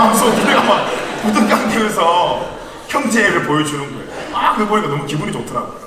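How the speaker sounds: fond, laughing storytelling; tickled narration. Real, not acted. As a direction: laugh as you remember the hug. Laughing while telling the story.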